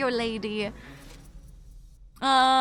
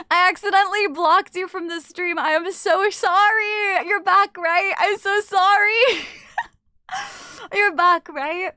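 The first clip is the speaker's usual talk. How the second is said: louder, higher pleading apology; frantic apology; 5 semitones higher, 5 dB louder than usual. Real, not acted. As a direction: apologise in a real fluster, drawing out the sorry. Panicked apology for blocking a viewer.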